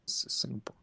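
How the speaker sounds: whispered answer. Retold or imitated. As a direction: whisper it like a secret. Secretive answer in an acted exchange.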